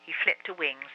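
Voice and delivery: ordinary delivery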